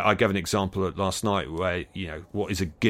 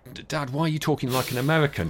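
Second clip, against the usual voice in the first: a higher, lightly voiced kid teasing their dad; teasing sons; 7 semitones higher than usual. Sons mocking Dad's American talk, light higher kid voice. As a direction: tease Dad like a grinning kid. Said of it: "And they said".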